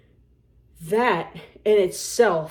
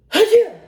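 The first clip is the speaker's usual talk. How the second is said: loud, high, explosive mock sneeze; sudden blasting achoo; big sneeze; 11 semitones higher, 14 dB louder than usual. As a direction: act out an almighty sneeze. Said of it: "this is my sneeze dominant hand".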